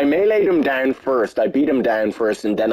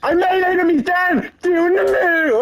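high, slow victory yell; triumphant shout. Real, not acted. Celebrating kill.

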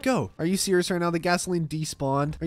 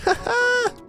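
high squealing laugh; high giggle. Real, not acted. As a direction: burst out laughing. Laughing at chairs.

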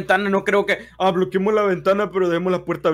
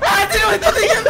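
high, loud, laughing scream; screaming with laughter while chased; panicked laughing yell; 7 dB louder than usual. Real, not acted.

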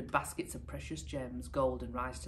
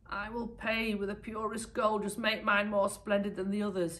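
higher, commanding minister voice; bossy demand, chin up; ordering; 7 semitones higher, 4 dB louder than usual. Acted. Greedy minister ordering the tailors, higher and bossy. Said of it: "he ordered".

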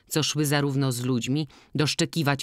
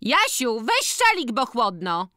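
high-pitched motherly call; calling to son; 9 semitones higher, 3 dB louder, slower than usual. A narrator's reading. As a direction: call out to your boy, starting very high and dropping. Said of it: "zawołała do syna". (wysokie nawoływanie syna przez matkę)

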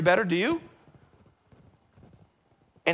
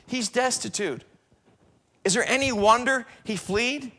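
normal talking voice

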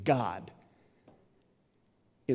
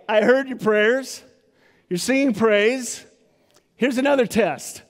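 voicing God; 7 semitones higher, 6 dB louder than usual. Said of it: "God's like".

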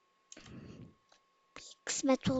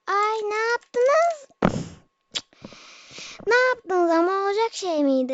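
dismayed cry; 5 semitones higher, 6 dB louder than usual. Real, not acted. Dismayed cry at teammates who knocked the ladder down. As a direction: wail at what they just did.

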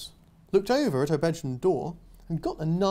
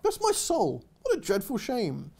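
put-on higher old-fashioned aardvark; fussy aardvark; 5 semitones higher than usual. Aardvark sympathising with the girl, fussy. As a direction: tut sympathetically.

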